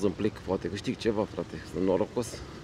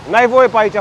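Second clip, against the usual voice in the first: loud, raised scolding; angry yell; 7 semitones higher, 7 dB louder than usual. Real, not acted. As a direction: tell him off sharply. Yelling again at offender.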